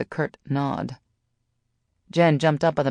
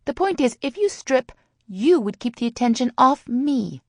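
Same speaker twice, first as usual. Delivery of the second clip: high, insistent snap; exasperated high-voiced retort; snapped; 10 semitones higher than usual. A narrator's reading. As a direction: press the point, pitched way up. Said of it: "Sally snapped".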